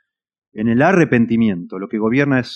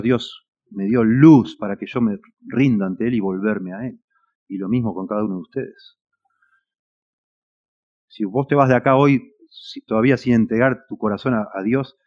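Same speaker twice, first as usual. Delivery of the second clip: ordinary talk, nothing marked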